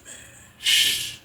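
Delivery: whispered shush, almost no voice; retold hush. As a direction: hush him in a breathy whisper. Retold hushing cellmate at night.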